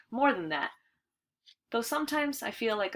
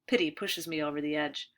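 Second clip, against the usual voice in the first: ordinary delivery, nothing marked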